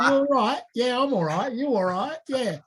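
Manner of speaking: a hammed-up Cockney exchange between two mates; Cockney greeting. Shown as a demonstration. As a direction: play both Cockney mates greeting each other. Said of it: "so talk like this".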